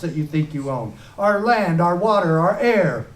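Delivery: impassioned stump-speech delivery; fiery rally speech. Acted. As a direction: rouse the crowd, hammer each line. Rally speech at full force.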